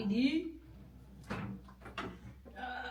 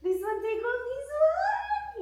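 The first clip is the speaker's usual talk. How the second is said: high, slow sing-song request; playful coaxing; 11 semitones higher, slower than usual. Real, not acted. Coaxing the child to undress. (malambing na pahimig na pakiusap)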